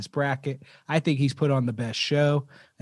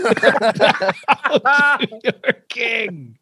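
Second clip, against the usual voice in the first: very slow, drawn-out, laughing through it; roaring tyrant king; 8 semitones higher, 6 dB louder, much slower than usual. Pretend. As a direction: stretch every word like a mock decree. Repeats the royal proclamation laughing.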